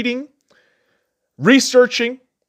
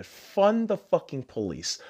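muttered slogan; 4 semitones lower, 10 dB quieter, slower than usual. Real, not acted. Repeats slogan, then shouts it.

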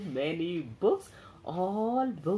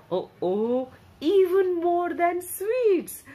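much higher, louder, slow marvel; excited; 10 semitones higher, 6 dB louder than usual. Acted. Storyteller marvelling to children, high and loud. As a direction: gasp in wonder for the children.

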